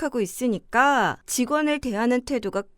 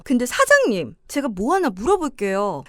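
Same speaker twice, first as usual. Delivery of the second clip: ordinary talk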